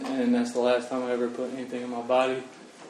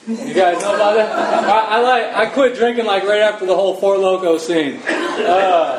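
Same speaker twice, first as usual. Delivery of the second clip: laughing through the words, higher and louder; 7 semitones higher, 8 dB louder than usual. Real, not acted. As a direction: chuckle nervously while telling it. Laughing while recalling drinking.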